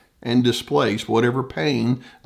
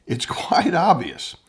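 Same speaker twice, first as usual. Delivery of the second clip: whispered aside with a laugh, mocking; 5 semitones higher than usual. Therapist mocking a narcissist's admission, whispered with a laugh. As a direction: breathe it out with a little laugh.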